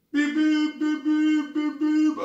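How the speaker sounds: high, loud mock beeping like the scooter's alarm; imitated warning beeps. Rider mimics the scooter's alarm that the whole city hears, high and loud. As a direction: beep it out like the scooter's speed alarm. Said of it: "And all city hear the voice".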